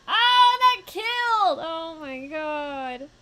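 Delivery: drawn-out high shout, easing slightly; loud, stretched cry of astonishment; shocked outcry. Real, not acted. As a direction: wail it in disbelief, very slowly. Shocked by a kill in game.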